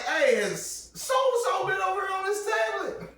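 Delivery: high, loud, half-laughing shout; retold calling out. Guest questions friend about the tablet, high laughing shout. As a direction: holler through a door while fighting a laugh.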